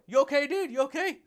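mock concerned skeptic. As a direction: needle him like a doubter who thinks you're nuts. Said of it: "what's that guy always fired up for".

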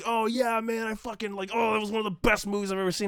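high, fast fake excitement; high mock rave; mock gushing. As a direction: rave about the movie. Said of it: "I wasn't like".

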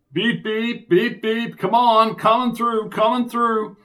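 high, loud beep-beep call. Acted. Sid parting the crowd.

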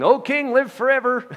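high, loud call up from the pit. Acted. Daniel calling up from the den to the king, loud. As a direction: shout it up to the king above.

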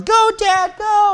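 high-pitched imitation of a cheering daughter; yelling daughter. Daughter cheering dad up the climbing wall, imitated yelling. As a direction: mimic a kid's yell in a very high voice. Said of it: "my daughter's yelling".

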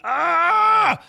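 long, high, loud "ahh"; excited scream. Real, not acted. Fan who has gotta see Avengers, excited scream. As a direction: let out a drawn-out fan scream.

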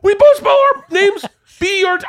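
cracking up at the coincidence; laughing excitedly. Retold or imitated. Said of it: "I'm, like, so excited".

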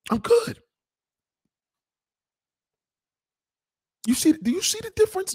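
shrill high remark; mock high voice; high squeak. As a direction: pitch it way up. High exaggerated voice.

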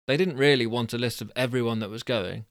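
usual speaking voice

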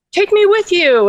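high-pitched frog plea; demanding frog. Acted. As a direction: call out high and insistent as the frog. Frog demands to come along.

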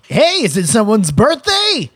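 chirpy waiter voice. Retold or imitated. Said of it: "the waiter was like".

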